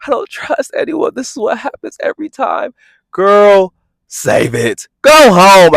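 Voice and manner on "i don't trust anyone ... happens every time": lightly imitated, high and whiny; a touch of mock sobbing; mock crying Scotty. Mocking Scotty crying in the car, high and whiny. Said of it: "Scotty starts crying in the car".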